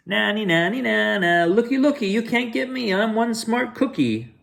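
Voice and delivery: high singsong taunting chant; cookie taunt. Acted. Cookie taunts the chasing bad boys in high singsong. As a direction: tease them like a cheeky runaway.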